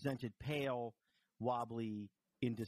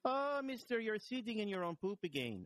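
high, badly imitated Jamaican nurse; Jamaican lilt; 11 semitones higher than usual. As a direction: put on a wobbly, high-pitched Jamaican lilt. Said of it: "kind of a Jamaican lilt".

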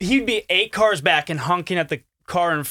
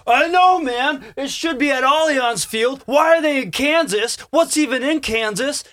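high, loud mock of the upset driver; chatty cabbie; 9 semitones higher, 4 dB louder than usual. Guest imitating the cab driver ranting about Kansas, loud and high. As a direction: ham up the outraged soccer fan.

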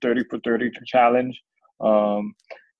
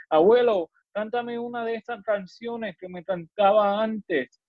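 much higher than own talking voice; pleading grandchild; 11 semitones higher than usual. Acted. Grandchild asking abuelo for an old song, pleading. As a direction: call out, then ask in a lilting plea. Poem figure asks grandfather.

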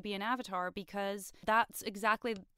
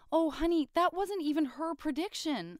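higher, pitying coo; talking to Aang in pity, raised and louder; pitying "oh honey"; 7 semitones higher, 3 dB louder than usual. Retold or imitated. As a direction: sympathize like with a sweet fool. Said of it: "it's like".

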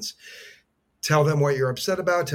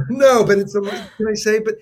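laughing; 9 semitones higher, 10 dB louder than usual. Real, not acted. Guest laughs with moved host.

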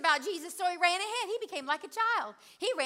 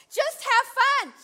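slow, loud, much higher cry; joyful shout; 8 semitones higher, 7 dB louder, slower than usual. Real, not acted. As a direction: shout it out in joy. Quoting God, exuberant.

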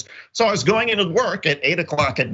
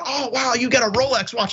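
impressed acquaintance; 9 semitones higher than usual. Retold or imitated. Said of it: "somebody had said".

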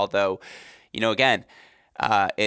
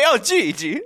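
high, laughing mock Gigi; high laughing imitation; laughing mock greeting; 11 semitones higher, 4 dB louder, slower than usual. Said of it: "you can't just say".

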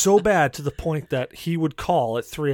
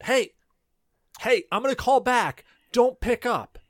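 higher, raised speakerphone voice; imitated speakerphone boss; 8 semitones higher than usual. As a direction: mimic a boss calling over speakerphone. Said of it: "I'd hear him, like, on speakerphone".

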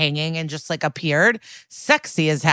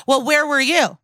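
higher, louder mock-accusing comeback; accusing deflection; 8 semitones higher, 4 dB louder than usual. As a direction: throw the question back at him, pitched up and louder. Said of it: "she goes".

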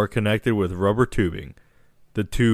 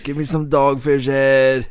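demanding patient voice; 4 semitones higher, 4 dB louder than usual. Retold or imitated. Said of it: "I'm like".

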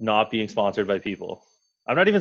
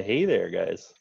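laid-back Canadian accent. Shown as a demonstration. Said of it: "it's more of like a".

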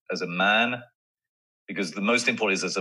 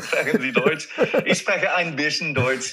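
put-on laughing German accent, higher; playful German with a laugh; 6 semitones higher, 3 dB louder than usual. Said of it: "answering in German, laughing".

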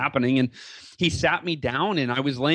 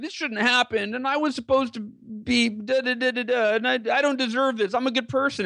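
higher, whining self-pity; mock the miserable wheelchair version of himself; whiny victim voice; 9 semitones higher than usual. Telling his dad how a victim would whine, higher and self-pitying. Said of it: "this victim mentality".

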